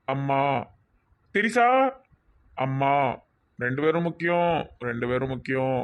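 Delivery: drowsy isolated words with long gaps, mostly low; talking in sleep. Acted. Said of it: "தூக்கத்துல வளருதான்".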